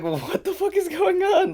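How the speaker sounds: high, incredulous what-the-fuck; retelling his shock at the stall; shocked outcry. Retold or imitated. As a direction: voice jumps way up in disbelief. Shocked quote in bathroom story.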